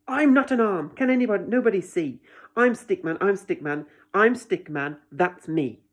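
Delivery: loud, frustrated protest; angry. A narrator's reading. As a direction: insist furiously that nobody sees him. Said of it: "He's getting very angry now".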